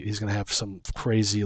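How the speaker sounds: ordinary delivery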